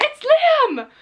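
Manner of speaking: very high-pitched starstruck squeal; uncool fan excitement. Acted. Fan losing it on seeing Liam in the street, excited squeal. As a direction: squeal it out, losing all cool. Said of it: "I'd be so uncool about it".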